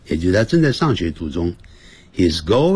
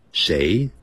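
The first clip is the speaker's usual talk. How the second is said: normal talking voice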